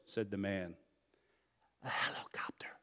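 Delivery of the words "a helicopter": voiceless hushed whisper; child's whisper. A narrator's reading. Child answering the boss's question, whispering. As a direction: whisper it close to the phone. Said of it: "whispered the child".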